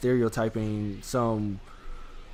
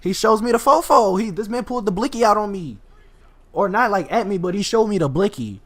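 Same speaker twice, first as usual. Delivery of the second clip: excited retelling; 7 semitones higher, 4 dB louder than usual. Real, not acted. Excited about the gun reveal.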